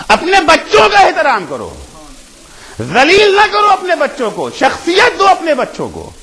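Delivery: high, loud ringing preacher's call; impassioned preaching. Real, not acted. Preacher urging the congregation to honour children, loud impassioned call. Preaching hadith at full force.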